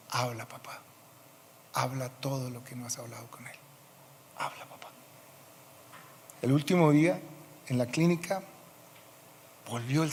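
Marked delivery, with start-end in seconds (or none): 0.1-4.9 voz suave. Retold or imitated. Anima al padre moribundo.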